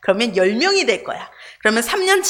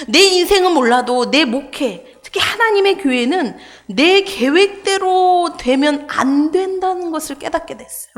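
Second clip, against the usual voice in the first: normal talking voice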